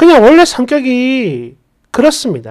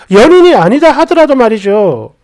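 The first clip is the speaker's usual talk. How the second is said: usual speaking voice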